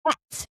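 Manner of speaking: "Twat" with a crisp English vowel; said properly. Retold or imitated. Correcting a friend, proper English pronunciation. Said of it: "you have to say it properly".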